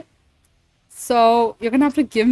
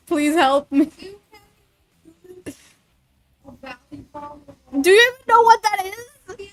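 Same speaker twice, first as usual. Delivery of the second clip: laughing; 6 semitones higher than usual. Real, not acted. Losing it laughing on stream.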